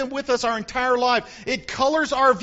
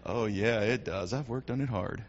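1 smug vanity; 12 semitones lower, 8 dB quieter than usual. Retold or imitated. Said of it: "are you one that goes".

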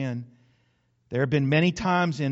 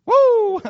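high loud joyful whoop; even bigger celebratory woo; louder whoop; 12 dB louder than usual. Real, not acted. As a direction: whoop it out to rouse the crowd. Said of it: "I'll do it again".

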